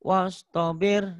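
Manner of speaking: "Wastabir" recited slowly and high, vowels kept short. Shown as a demonstration. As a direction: chant "Wastabir" slowly without lengthening. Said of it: "Jangan panjang".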